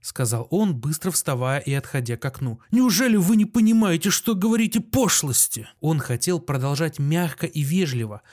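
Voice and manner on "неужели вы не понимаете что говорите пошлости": high, loud exasperated question; indignant protest. A narrator's reading. Doctor losing patience with his visitors, indignant. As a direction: protest as temper starts to break.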